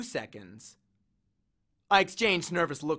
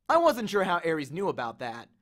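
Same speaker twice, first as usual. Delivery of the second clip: ordinary delivery, nothing marked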